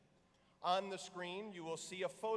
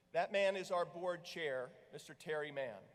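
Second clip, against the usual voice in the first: normal talking voice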